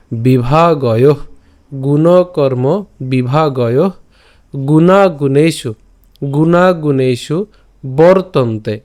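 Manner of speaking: গুণকর্ম বিভাগয় said slowly, every syllable clear; slow syllable drill. Shown as a demonstration. Teacher drilling verse words slowly for students. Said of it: "উচ্চারণটা কেমন হচ্ছে".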